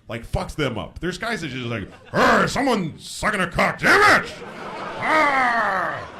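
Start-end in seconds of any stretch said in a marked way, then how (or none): 2.1-6.1 loud, high mock rage, yelling out; hammed-up furious bigot with drawn-out groans; outraged rant. Mocking people enraged at gays.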